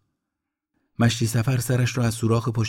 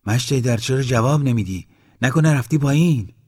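louder, higher insistent questioning; raised voice, pressing for an answer; calling out; 5 semitones higher, 6 dB louder than usual. A narrator's reading. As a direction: call down to someone who isn't answering. Calls down into hole, unanswered.

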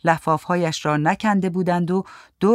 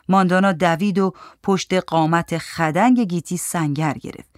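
ordinary delivery

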